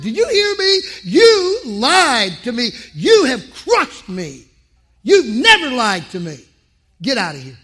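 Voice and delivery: hurt father shouting at son; angry shout. Retold or imitated. Father yelling at son who lied, angry shout.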